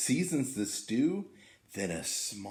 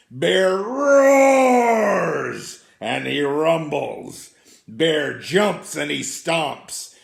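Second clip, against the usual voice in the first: loud, slow, growling telling; roaring bear; 2 semitones higher, 12 dB louder, much slower than usual. A narrator's reading. Reading the angry bear waking, loud and slow. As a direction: roar it out slowly like an angry bear.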